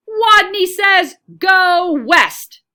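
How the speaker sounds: loud, high called command; Wadney calls the command with all his might; strong voice. A narrator's reading. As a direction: call it so loud he must cover his ears. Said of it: "In a voice so strong".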